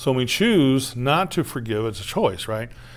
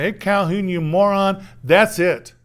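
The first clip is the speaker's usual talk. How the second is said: loud, higher, exasperated rebuke; mock scolding; 6 semitones higher, 5 dB louder than usual. God scolding the preacher, mock-angry and loud. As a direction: snap at him like a fed-up boss.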